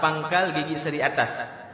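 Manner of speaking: ordinary talk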